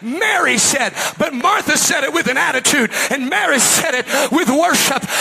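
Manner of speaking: high, loud revival preacher shout; impassioned pulpit shouting; full-force preaching. Real, not acted. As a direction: preach it at the top of your voice.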